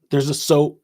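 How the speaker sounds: normal talking voice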